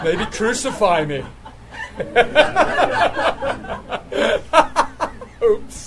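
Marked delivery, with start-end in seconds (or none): 1.7-5.4 breathy giggle up high; high unvoiced laugh. Real, not acted. Preacher laughing at skeptics, airy giggle. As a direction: laugh at the crowd's doubt.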